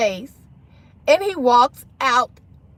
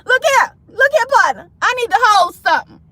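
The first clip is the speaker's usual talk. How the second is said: higher, louder, punchy mock demand; bold money ask; 8 semitones higher, 3 dB louder than usual. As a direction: ask for a loan with playful swagger. Replays the money ask boldly.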